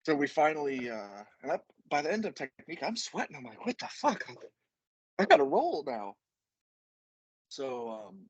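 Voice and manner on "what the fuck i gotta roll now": high-pitched disbelieving retelling; mock exasperation. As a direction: act out your own dismay, pitched way up. Retold exasperation while sweating at training.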